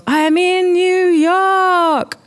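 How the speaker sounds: slow, high, loud jubilant cry; drawn-out elation. A narrator's reading. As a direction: proclaim it, stretching every word up high. Repeats it louder and longer, elated.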